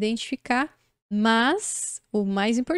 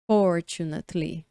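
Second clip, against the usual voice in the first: lower-pitched "Fortunately", stressed on FOR; first-syllable stress; 5 semitones lower than usual. Shown as a demonstration. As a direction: model "Fortunately" as FOR-chu-nat-ly. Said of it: "a primeira é a tônica". ("Fortunately" grave, com força no FOR)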